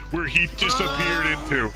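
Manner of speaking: normal talking voice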